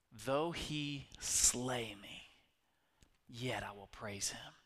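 slow, then whispered vow; whispered devotion; hushed Job quote. Acted. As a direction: breathe out the last words. Preacher quoting Job, hushed.

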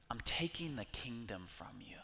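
hushed, ominous whisper; whispered verdict. Acted. God whispering judgment to the disobedient, ominous. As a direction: breathe it out like a final judgment.

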